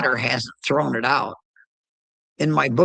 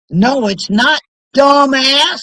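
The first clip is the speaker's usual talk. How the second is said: higher, louder scornful snap; angry retort; 11 semitones higher, 7 dB louder than usual. Real, not acted. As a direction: bite back at the critic. Retort to mocked critic.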